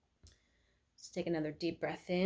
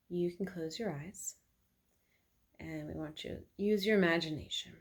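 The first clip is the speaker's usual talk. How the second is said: guided meditation. Acted. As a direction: lead them calmly through the imagined walk.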